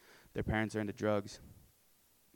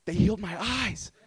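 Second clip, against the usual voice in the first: high, loud jubilant holler; imitating joyful shout; 10 semitones higher, 10 dB louder than usual. Healed lady calling out at a house, imitated joyful shout. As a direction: proclaim it to the whole street.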